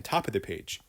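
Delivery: ordinary delivery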